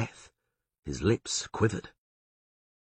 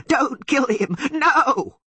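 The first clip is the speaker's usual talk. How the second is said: much higher, louder frightened cry; bleating cry; 8 dB louder, much slower than usual. A narrator's reading. Torqua crying out to save the Witcher, high and loud. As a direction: cry out to stop him. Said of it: "bleated Torqua".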